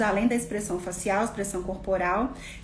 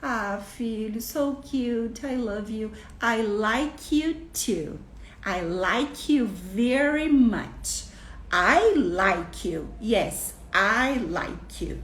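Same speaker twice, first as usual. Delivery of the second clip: affectionate parent repeating; much slower than usual. Acted. Parent repeating the right phrase to the child, slowly and tenderly. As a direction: gently stretch it out for your child. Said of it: "Você repete".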